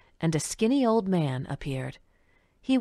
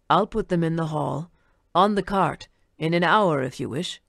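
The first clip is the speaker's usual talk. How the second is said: put-on old man voice.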